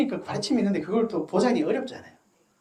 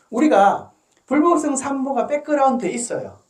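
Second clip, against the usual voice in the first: usual speaking voice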